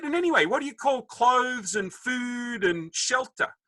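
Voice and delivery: high, slow mock-indignant question; exasperated dad. Dad listing what he provides, mock indignation. Dad challenges son, acted quarrel.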